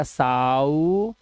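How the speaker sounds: साउ dragged very slowly into a long "ooo"; low, stretched साउ, holding the final vowel; sounding out double O. Shown as a demonstration. Teacher imitates a learner spelling with double O, sounding out. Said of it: "या फिर double ओ लगा देगा".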